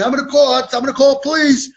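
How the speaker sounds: loud, high mock threat; hammed-up indignant yelling; drunken scene. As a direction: holler like an aggrieved drunk. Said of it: "what a scene".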